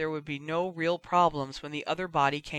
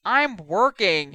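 loud, slow, higher-pitched shout; shouting back; 5 semitones higher, 9 dB louder, slower than usual. A narrator's reading. Busy brother answering his sister from afar, shouting back. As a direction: shout back, drawing the words out. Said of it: "he shouted back".

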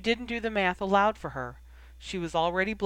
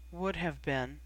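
subdued, softer echo; dawning realization; 5 dB quieter, slower than usual. A narrator's reading. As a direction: repeat it quietly as it sinks in. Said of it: "cold realization creeping over him".

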